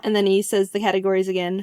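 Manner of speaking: usual speaking voice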